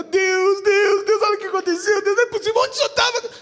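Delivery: imitating a frantic prayer shouted at the sky; desperate prayer. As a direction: cry out to God in mock despair. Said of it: "A gente às vezes ora".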